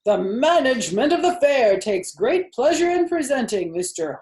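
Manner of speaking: loud, high, grand fair announcer; booming pompous loudspeaker call. A narrator's reading. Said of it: "said the loudspeaker in a pompous voice".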